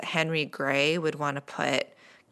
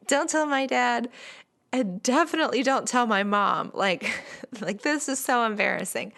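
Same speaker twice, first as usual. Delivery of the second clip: put-on higher-pitched, flustered girlish pleading; mock embarrassed; 6 semitones higher than usual. Smitten Amy begging him not to tell, embarrassed. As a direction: play mortified, breaking into a small laugh. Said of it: "because she was so embarrassed".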